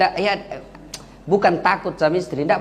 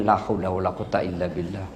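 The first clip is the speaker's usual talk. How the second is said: deep exasperated sigh; 11 semitones lower, 6 dB quieter than usual. Acted. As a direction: drop the voice low and quick, mock despair. Mock despairing exclamation. (seruan putus asa yang dalam dan berat)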